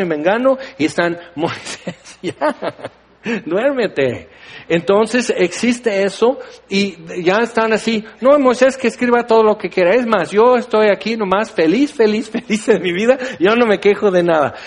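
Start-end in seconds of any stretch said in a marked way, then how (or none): 1.3-4.3 laughing mock plea. Mocking grumblers, preacher laughs. 8.2-14.5 higher, quick, laughing fake contentment; fake cheerful. Grumblers pretending to be happy, mock cheerful.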